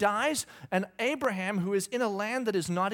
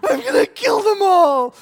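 high, very loud frazzled scream; imitated wife about to pop; 12 semitones higher, 15 dB louder than usual. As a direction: explode like a mother at breaking point. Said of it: "she's about to pop".

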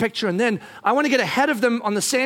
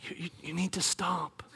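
very soft, lower, hesitant; nice, careful; 4 semitones lower, 13 dB quieter than usual. Retold or imitated. Wife gently correcting husband, careful hush. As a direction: break it to them very gently. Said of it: "in the nicest, most careful way".

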